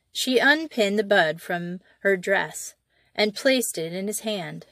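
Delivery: ordinary delivery, nothing marked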